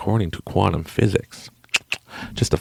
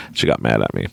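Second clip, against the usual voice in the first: usual speaking voice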